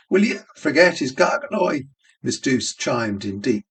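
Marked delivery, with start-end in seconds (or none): 0.1-1.8 crying out. Acted. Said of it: "Miss Kennedy cried".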